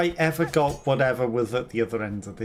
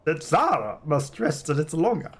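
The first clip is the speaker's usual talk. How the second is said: courteous companion character; 4 semitones higher than usual. Acted. Character explains that Zara must rest, high courteous role voice.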